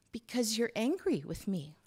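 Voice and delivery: a small granddaughter, a bit scared; child Hazel voice. Acted.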